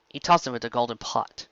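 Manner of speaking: plain reading voice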